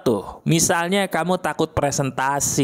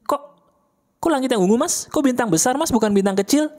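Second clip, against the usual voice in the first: mock puzzled question; 9 semitones higher, faster than usual. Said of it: "listener puzzled by lyrics".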